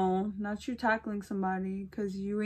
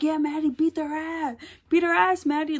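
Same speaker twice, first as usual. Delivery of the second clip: higher, louder mock cheer to fight; egging on; 7 semitones higher, 5 dB louder than usual. Friend urging Maddie to fight, loud mock cheer. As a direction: egg her on to fight, hammed-up.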